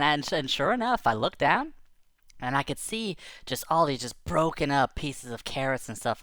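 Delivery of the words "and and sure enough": usual speaking voice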